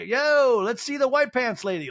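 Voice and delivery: high, loud emcee shout; mock call-out. Stage host calling up the pants lady, mock shout. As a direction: holler it playfully to the room.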